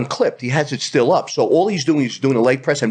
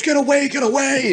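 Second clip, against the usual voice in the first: imitating panicked guy; 11 semitones higher than usual. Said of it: "he goes".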